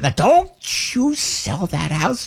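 high, then slow and firm warning mother; stern mother. Retold or imitated. Mother sternly warning son not to sell, high slow voice. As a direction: warn him slowly as Mom. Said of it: "She looked at me".

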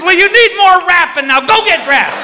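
high, nagging reproach; high-pitched mock scolding; scolding wife. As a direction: tell him off in a high wife voice.

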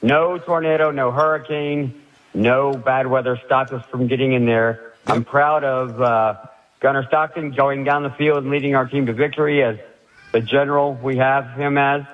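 normal talking voice

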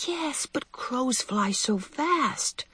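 put-on high, soft, drawn-out child's voice; slow and small, like an anxious little one; worried child. Worried little Cynthia answers her sister.

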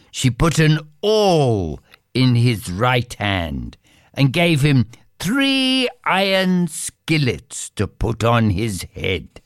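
plain reading voice

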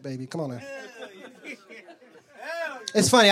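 usual speaking voice

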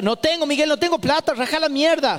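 high, sharp mother's refusal; mock shout at son. Mother snapping at her nagging son, high and sharp.

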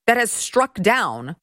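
ordinary talk

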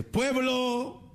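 high, slow pompous orator; high slow mock proclamation; mock Hidalgo speech. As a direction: proclaim like a hammed-up hero. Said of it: "se pone a gritar".

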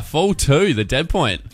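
ordinary delivery

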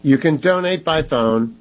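ordinary delivery

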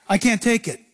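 ordinary talk, nothing marked

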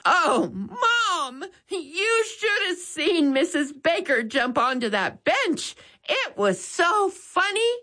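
high voice breaking with laughter; boy telling Mom the funny scene while laughing; laughing hysterically. A narrator's reading. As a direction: squeeze words out through laughter. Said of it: "laughing hysterically".

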